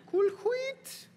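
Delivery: very high squeak on "Cool wheat"; squeaky high voice. Acted. Catchphrase echoed in squeaky voice.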